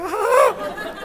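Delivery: high gasp of delight; delighted squeal. Retold or imitated. Wife tasting the cookie, high delighted squeal. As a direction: squeal with joy.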